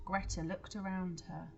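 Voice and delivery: plain reading voice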